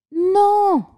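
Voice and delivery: put-on high pleading child's no; child begging. A narrator's reading. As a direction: beg like a small boy. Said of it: "supplia le petit".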